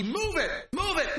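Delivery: high-pitched yelling at a stubborn donkey; angry command. Retold or imitated. Balaam beating his stopped donkey, high angry command. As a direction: whip the words out at the animal.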